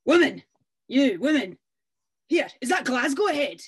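put-on loud, rude, barking at the old woman; a gruff stranger bellowing from his stallion; rude shouted demand. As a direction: yell it down from horseback like a brute. Rude rider demands directions.

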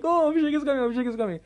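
high-pitched mock excitement; excited classmates. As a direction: imitate thrilled kids passing on news. More excited classmates announcing him.

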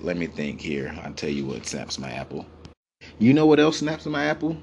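usual speaking voice

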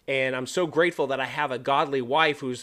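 normal talking voice

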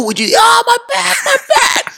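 very high cries of "my back"; mock pained wail. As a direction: imitate a friend crying out with a hurt back. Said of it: "He goes".

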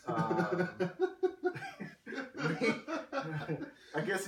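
high laughing. Real, not acted. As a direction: crack up mid sentence. Laughing about Jaden.

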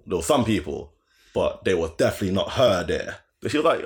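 ordinary talk

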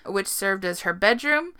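ordinary delivery

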